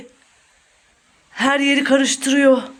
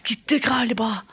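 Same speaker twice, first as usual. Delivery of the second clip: low, breath-only whisper; 3 semitones lower, 6 dB quieter than usual. Pretend. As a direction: whisper cautiously from hiding. Peeking out from hiding.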